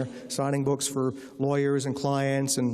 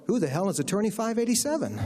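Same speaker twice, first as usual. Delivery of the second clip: higher-pitched incredulous question; retold bewilderment, pitched up; baffled colleagues; 8 semitones higher than usual. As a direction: imitate a whole office asking in bafflement. Whole firm puzzling over the story.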